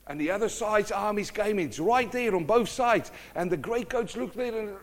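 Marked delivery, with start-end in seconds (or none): none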